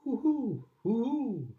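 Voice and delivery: high hooting owl call. Shown as a demonstration. Reading the owl sound to a child, high hoots. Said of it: "He can sound like an owl".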